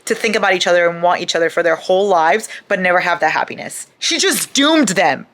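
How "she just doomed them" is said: slow, higher, louder outrage; seething disbelief; outraged. Real, not acted. Outrage at Tori.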